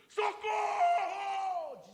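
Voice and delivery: drawn-out high desperate cry; inner cry for help. Retold or imitated. The soul crying for help, long desperate shout. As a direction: stretch the cry out slowly. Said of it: "era um grito".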